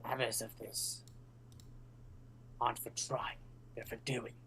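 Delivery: soft, hushed mysterious voice, broken by pauses; whispered reply. Acted. Game master answers as the whispers in a player's head, hushed. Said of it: "does it feel like I can whisper back".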